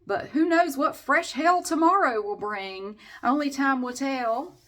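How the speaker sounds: high, slow teaser delivery; lilting theatrical suspense; mock-dramatic. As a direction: draw it out like a serial cliffhanger.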